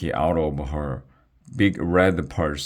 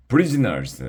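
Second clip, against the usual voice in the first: lightly voiced commanding dragon, higher; dragon guard; 8 semitones higher, 3 dB louder than usual. A narrator's reading. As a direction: call the captives to order. Dragon addresses captives.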